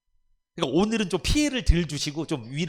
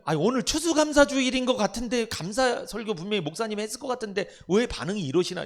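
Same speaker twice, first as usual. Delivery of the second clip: high, louder mock puzzlement; sarcastic family member; 7 semitones higher, 3 dB louder than usual. 가족이 화난 교인을 비꼬며 높고 크게 묻는 말투. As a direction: tease the churchgoer with feigned surprise.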